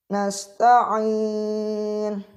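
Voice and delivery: nasta'in with a clear, held ain in the throat; correct ain. Shown as a demonstration. Teacher shows students the right ain, slow and held. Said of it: "bacanya menggunakan ain".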